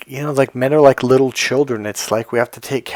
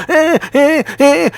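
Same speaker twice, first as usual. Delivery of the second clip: high, loud repeated "eh" squeals; mimicked freak-out squeal; 6 dB louder, slower than usual. Telling how Rain Man reacts to a broken routine, mimicked freak-out. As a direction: imitate a panicked squealing meltdown in short bursts. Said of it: "he would freak the fuck out".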